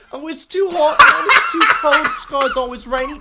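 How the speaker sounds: loud, high-pitched comic whine; hammed-up moaning; mock complaining. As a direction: complain theatrically about the weather. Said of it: "Complaining about things".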